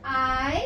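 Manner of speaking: "आई" as a drawn-out glide "ai"; long I sound. Shown as a demonstration. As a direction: say "आई" slowly like the letter's name I. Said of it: "long sound". ("आई" को लंबा खींचकर)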